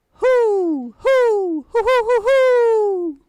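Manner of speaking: high, loud, slowly drawn-out owl hoots. Retold or imitated. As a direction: hoot like a great horned owl calling in the woods. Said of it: "The sound of a great horned owl".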